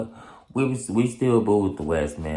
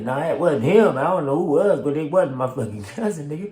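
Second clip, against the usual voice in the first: high, louder insistent denial; imitating the cousin; 9 semitones higher, 5 dB louder than usual. The cousin insisting to the officers, high and loud.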